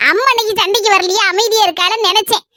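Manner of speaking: ordinary talk, nothing marked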